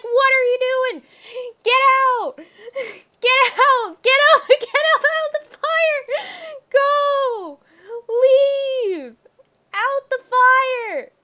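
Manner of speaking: high, loud shrieks for the other character; frantic mock yelling. Voicing the freaked-out companion yelling at Carmen to leave. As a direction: scream it as the panicked man. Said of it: "He's like".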